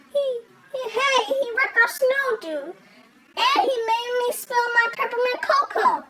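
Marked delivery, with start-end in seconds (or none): none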